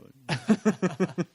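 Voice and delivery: grudging giving in. Retold or imitated. Retelling the staff caving on the logo, mock grudging. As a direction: stretch it out like a sulky yes. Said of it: "Okay, all right".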